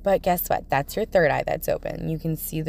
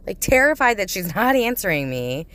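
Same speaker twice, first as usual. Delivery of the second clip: usual speaking voice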